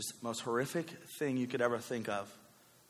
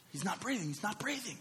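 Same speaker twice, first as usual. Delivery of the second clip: high, hushed panic; urgent half-whispered alarm, pitched high; panicked wife; 8 semitones higher, 4 dB quieter than usual. Retold or imitated. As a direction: imitate a frightened mother, voice thin with air. Wife panicking as baby stops breathing.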